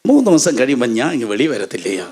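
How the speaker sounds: soothing divine figure; acted reassurance. Lord reassures the alarmed Enoch.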